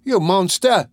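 high, loud accusation; horrified outcry. A narrator's reading. Boy to the murderer in horror, high outcry.

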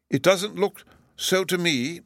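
high, slow dignitary's voice; unhurried high-voiced official; Lord Chancellor. A narrator's reading. Lord Chancellor doubts Bruno.

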